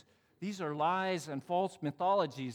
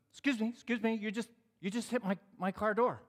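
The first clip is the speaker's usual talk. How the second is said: higher-pitched, affronted complaint; indignant young man; 8 semitones higher than usual. Retold or imitated. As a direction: imitate an aggrieved young driver. Acting out the indignant young driver.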